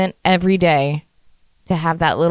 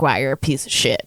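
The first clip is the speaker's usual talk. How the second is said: mock self-insult; 7 semitones lower, 4 dB quieter than usual. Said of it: "I'd be like".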